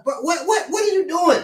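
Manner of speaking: high, stammered mock challenge; acted alarmed protest. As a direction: stumble on "what", pitched right up. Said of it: "and you like".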